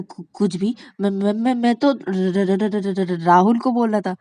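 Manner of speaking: repeated syllables, flustered denial; panicked stammer. Acted. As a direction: stammer out a hasty excuse. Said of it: "तुम इतने हकला क्यों रहे हो".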